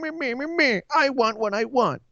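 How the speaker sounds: high whiny me me me; spoiled child voice. Pretend. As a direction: whine like a toddler demanding a toy. Self-mocking spoiled fan voice.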